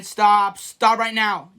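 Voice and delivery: usual speaking voice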